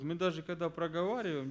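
ordinary talk, nothing marked

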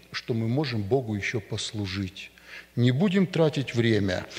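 ordinary talk

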